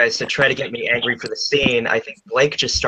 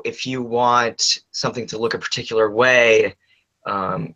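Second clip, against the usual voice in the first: ordinary delivery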